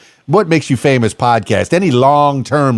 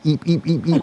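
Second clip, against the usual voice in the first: squeaky eeps; 4 semitones higher, 5 dB quieter than usual. Pretend. Squeaky mouse noises at camera.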